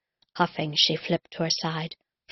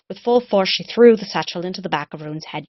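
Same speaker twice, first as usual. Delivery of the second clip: louder, driving the action hard; forceful narration; 2 semitones higher, 8 dB louder than usual. A narrator's reading. Narrating the attack to listeners, forceful and louder. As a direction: narrator punching the attack home.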